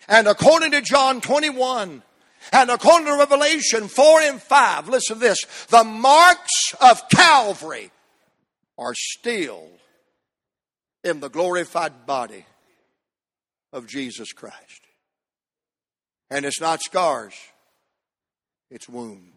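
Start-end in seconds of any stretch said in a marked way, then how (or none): none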